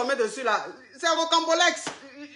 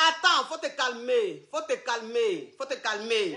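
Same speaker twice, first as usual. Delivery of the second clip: fading softer on each calmer; calming down; 4 semitones lower, 9 dB quieter than usual. Acted. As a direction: soothe him, letting the voice drop away. Coach soothes upset caller.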